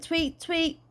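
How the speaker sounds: high-pitched imitated birdsong; Dad pretending to be a chirping bird; bird tweeting. A narrator's reading. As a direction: tweet like a little bird, in pairs. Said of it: "He went".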